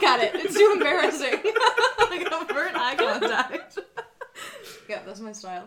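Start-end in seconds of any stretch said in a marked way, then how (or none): 0.4-3.9 giggling through embarrassed words; laughing. Real, not acted. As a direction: laugh while owning up. Laughing at own fist bump.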